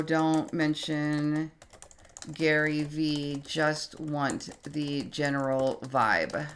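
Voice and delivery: slow and soft, typing along; slow dictation. Real, not acted. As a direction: murmur it slowly as you type. Typing a correction aloud.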